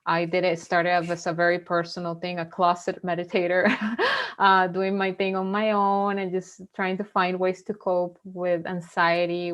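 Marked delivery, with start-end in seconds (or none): 3.8-4.3 quick high giggle; a light giggle at her own joke; short laugh. Real, not acted. Guest calling herself a closet meditator, giggling.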